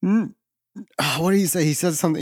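ordinary delivery

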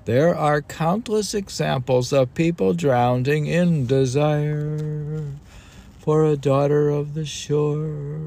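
put-on high, loud, solemn recitation of old text; declaiming recital. Reading an ancient text aloud to a co-host, solemnly declaimed. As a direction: read it like scripture from a lectern.

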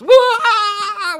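high, very loud "woo" cheer; joyful astonished whoop. Retold or imitated. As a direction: whoop it out like you just got great news. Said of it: "The joy-filled, astonished kind of laughter".

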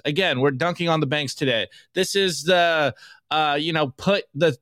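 ordinary delivery

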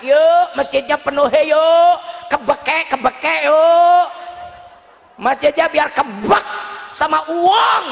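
rallying call with drawn-out, rising shouts; Ustadz Janto. Retold or imitated. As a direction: imitate a preacher urging the crowd along. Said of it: "tadi Ustadz Janto ngajak".

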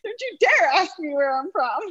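high, hammed-up warning with stress on dare; mock indignant. Student scolding a stranger in jest, high mock indignation. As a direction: pretend outrage at the question. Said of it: "I was so offended".

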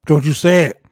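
pleading shout. Real, not acted. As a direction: beg him loudly not to say it. Begging friend not to say Goldberg.

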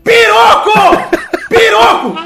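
very high, loud, drawn-out yells; shouting. Retold or imitated. Ricardo yelling his surname to the clerk who can't hear. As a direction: scream the name over and over. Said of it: "ele começou a gritar".

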